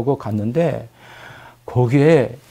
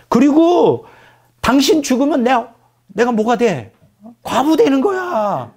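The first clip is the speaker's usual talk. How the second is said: climbing higher into a pitched protest; angry refusal; 5 semitones higher than usual. Retold or imitated. Wife refusing to go with Moses, high angry protest. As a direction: refuse him, rising toward a high pleading cry. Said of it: "이제 막말도 하고".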